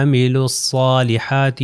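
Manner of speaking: عملوا الصالحات as "'amilus-saalihaati"; عملوا الصالحات, alifs, waw and lam silent; joined, heavy saad. Shown as a demonstration. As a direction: recite عملوا الصالحات with thick doubled s. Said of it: "الف اور لام نہیں پڑھا جائے گا".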